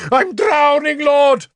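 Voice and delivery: high, loud drowning cry; panicked shout. A narrator's reading. Bishop dropped in shallow pond, high panicked shout. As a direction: shout to heaven for rescue. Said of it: "Sansom shouted".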